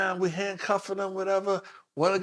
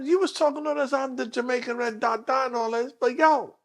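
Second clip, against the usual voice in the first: higher teasing jab; teasing mockery; 8 semitones higher than usual. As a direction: needle the tough guy. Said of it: "So I said to him".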